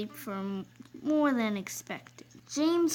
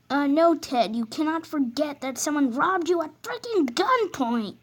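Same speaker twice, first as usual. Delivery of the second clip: louder incredulous telling-off; exasperated scolding; 5 semitones higher, 6 dB louder than usual. Acted. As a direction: scold him in disbelief. Driver scolding Ted.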